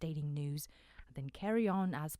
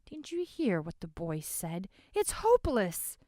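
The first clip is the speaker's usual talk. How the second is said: despairing; 4 semitones higher, 8 dB louder than usual. Acted. Despairing man.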